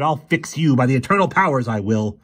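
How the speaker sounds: high, loud vengeful threat, settling at the end; loud high vow of revenge; furious threat. A narrator's reading. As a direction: threaten him with fury. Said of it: "he roared".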